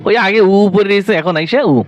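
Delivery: normal talking voice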